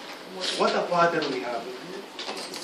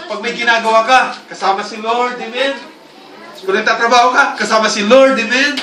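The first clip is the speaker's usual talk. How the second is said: building louder and higher with each amen; rising preaching call; 5 semitones higher, 7 dB louder than usual. Real, not acted. Preacher rallying the congregation, louder with each amen. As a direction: drive the congregation to answer amen.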